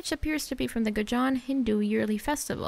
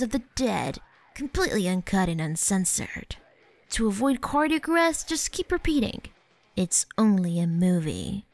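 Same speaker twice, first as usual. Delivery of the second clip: honest trailer voice. Pretend. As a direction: announce it like an over-the-top trailer. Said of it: "my best attempt at the honest trailer voice".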